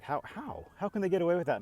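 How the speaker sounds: high-pitched exasperated question; exasperated disbelief. Real, not acted. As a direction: ask in baffled outrage. Disbelief at dealers.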